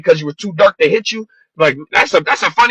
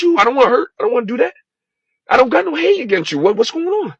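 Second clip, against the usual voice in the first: pleading higher and higher, close to tears; emotional, voice breaking; 8 semitones higher than usual. Real, not acted. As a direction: raw emotional plea, pitched high and straining. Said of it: "Sorry, I got too emotional".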